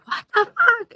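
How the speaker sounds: shocked out of sleep, voice shooting up; startled disbelief. Retold or imitated. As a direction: woken by pots and pans, shocked. Woken by banging pots.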